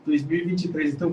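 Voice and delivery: ordinary talk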